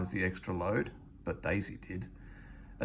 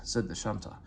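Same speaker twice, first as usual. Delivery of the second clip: ordinary delivery, nothing marked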